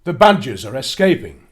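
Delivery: giant's booming bellow. Acted. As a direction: roar it out full force. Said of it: "Crazy Cameron bellowed".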